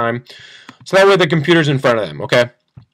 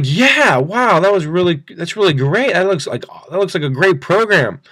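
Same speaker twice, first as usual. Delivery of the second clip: imitated excited prospect; 4 semitones higher than usual. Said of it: "And they're like".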